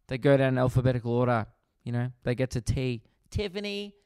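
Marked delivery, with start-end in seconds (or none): 3.3-3.9 high, softer roll-call voice; teacher calling roll. Acted. Teacher working down the alphabetical register, high soft roll-call voice. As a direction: call the next name lightly and high.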